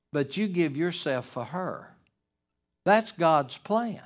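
ordinary talk, nothing marked

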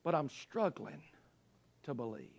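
very soft, low admission; hushed confession of doubt. Acted. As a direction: sink almost to nothing after a long pause. Hushed confession after loud cry.

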